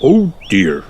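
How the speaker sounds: mild dismayed groan; a storybook dad's understated groan. A narrator's reading. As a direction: sigh it out with a touch of dismay. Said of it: "groaned Daddy Pig".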